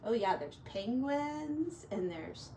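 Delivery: plain reading voice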